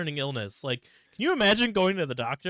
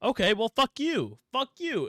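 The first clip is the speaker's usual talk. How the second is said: high, loud retorting fuck you; mock angry retort; 8 semitones higher, 4 dB louder than usual. Brothers snapping back at Santa, mock angry. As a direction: spit it back at each other. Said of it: "both of 'em are like".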